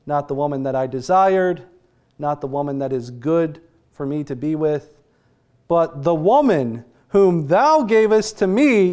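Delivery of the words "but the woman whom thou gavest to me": blaming Adam. Retold or imitated. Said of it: "the first words out of his mouth is".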